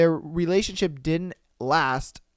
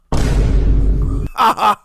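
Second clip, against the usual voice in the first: loud burst of laughter; 2 semitones higher, 9 dB louder than usual. Real, not acted. Reacting to shocking thread.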